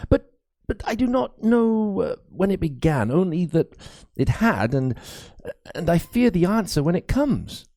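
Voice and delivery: put-on nervous young priest apologizing to Crispin; high, timid priest, anxious and halting; fearful young priest. A narrator's reading.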